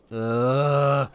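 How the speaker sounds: loud exaggerated groan of disgust; mock groan. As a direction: groan like a bored viewer. Disgusted groan at mocked video.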